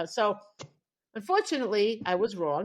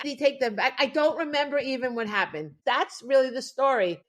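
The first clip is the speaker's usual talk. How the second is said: ordinary talk, nothing marked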